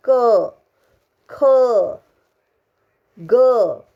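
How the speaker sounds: क ख ग each said with a clear अ vowel; क, ख, ग pronounced with the full अ sound; full syllable demo. Shown as a demonstration. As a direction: sound out क, ख, ग for the class. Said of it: "अगर क बोलते हो तो इसमें अ का".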